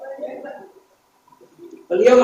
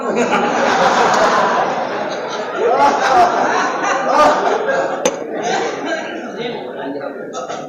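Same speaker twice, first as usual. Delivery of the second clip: long breathy laugh; 3 semitones higher than usual. Real, not acted. Laughter after joke.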